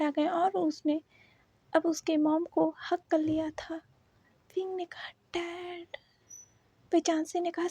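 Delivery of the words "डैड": soft whisper. A narrator's reading. Wing sees his dad on screen.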